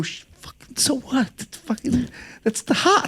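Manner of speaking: soft, strained, partly whispered; retold hushed urgency. Answering an ex worried they can hear, hushed urgency. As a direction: keep it down but urgent, broken up.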